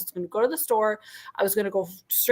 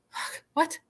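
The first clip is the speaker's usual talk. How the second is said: higher, breathless disbelief; shocked whisper; 7 semitones higher, 6 dB quieter than usual. Retold or imitated. Told it's a different Target, whispered shock. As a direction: gasp it out under your breath.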